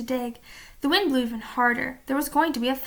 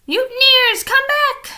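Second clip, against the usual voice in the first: high, very loud furious shout; captain yelling at his deserting crew; 11 semitones higher, 9 dB louder, slower than usual. A narrator's reading. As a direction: bellow it after fleeing men. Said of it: "shouted Captain Bones".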